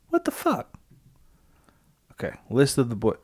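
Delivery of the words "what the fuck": sudden high-pitched what-the-fuck; surprised. Real, not acted. Caught off guard while scrolling the character list, surprised. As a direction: squeak it out in real confusion. Searching list, confused by result.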